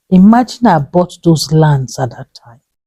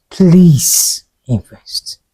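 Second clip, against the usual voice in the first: whispered plea; much slower than usual. Real, not acted. Whispering to viewers, please invest slowly. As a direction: drop to a whisper to press the point.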